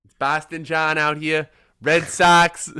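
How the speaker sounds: drawn-out, hammed-up Boston accent. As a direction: play a Boston character, stretching the vowels. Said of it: "little John Boston character".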